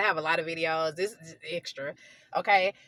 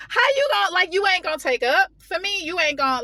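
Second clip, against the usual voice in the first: high-pitched, loud protest; worked-up, stumbling indignation, pitch way up; indignant outrage; 9 semitones higher, 4 dB louder than usual. Retold or imitated. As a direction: demand loyalty in retold disbelief. Retelling outrage at unloyal listener.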